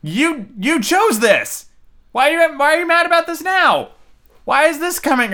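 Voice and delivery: high, incredulous mock rant at Talia; exasperated disbelief. Viewer scolding Talia for her change, high exasperated rant. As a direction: plead with her like it makes no sense. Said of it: "And I was like".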